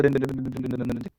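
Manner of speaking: soft, low droning repetition fading away; a quiet monotone whirr of syllables; mimed spinning. Shown as a demonstration. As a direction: chant it on one note, letting it die down. Acts out the earth spinning.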